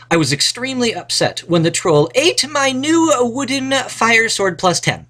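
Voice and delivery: high, bright storybook hero reading; adventurer voice. Acted. Teacher reads the adventure sentence to the class in a high hero voice. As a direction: read it like the hero telling his own tale.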